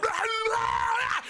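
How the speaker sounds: high garbled roar; imitated wild yell. Sweaty man jumping from a bush, wild yell. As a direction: roar nonsense like an ambusher. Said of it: "jumped out of a bush and went".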